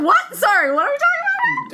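squeaky mock bewilderment; retold disbelief. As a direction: act out a baffled, high-voiced interruption. Acting out own startled reaction.

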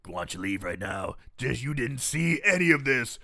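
low monster voice dismissing someone; Wishmaster's menace. Retold or imitated. Acting Wishmaster's warning to student.

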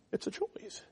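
soft, breathless whisper on choice; hushed emphasis on the key word; whispered point. Real, not acted. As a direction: let it fall to a whisper. Sermon point dropped to a whisper.

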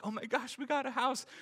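high squeal of delight; excited. Retold or imitated. Couple jumping up and down over the house, high thrilled squeal. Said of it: "We're jumping up and down".

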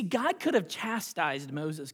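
normal talking voice

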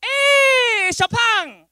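high, booming, long-drawn holler; barber's greeting shout. Retold or imitated. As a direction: call out a huge, stretched greeting. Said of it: "He'd look at me and go".

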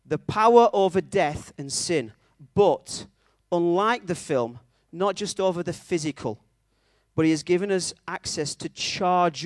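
emphatic, building sermon climax in measured phrases; impassioned preaching. Real, not acted. Preacher calling the church to resurrection power, impassioned preaching. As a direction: proclaim each phrase with conviction.

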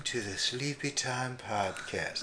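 very soft, drowsy welcome; sleepy podcast host. Acted. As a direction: put on a hushed bedtime voice. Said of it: "I'm sleepy".

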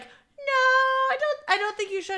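high-pitched imitation of an objecting friend; mock worried protest. Storyteller imitates friend objecting to the driving, mock worried protest.

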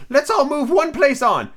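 very high, slow announcement; hammed-up eccentric host; demanding Hatter. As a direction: call it out high and drawn-out. Hatter voiced in story.

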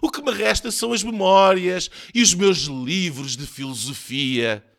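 hammed-up moaning; whining lament. A narrator's reading. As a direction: keep lamenting, high and put-upon. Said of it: "lamuriou-se ele".

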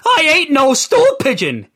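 high, desperate denial; high frightened plea; pleading for mercy. Acted. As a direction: beg for mercy. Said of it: "He pleaded for mercy".

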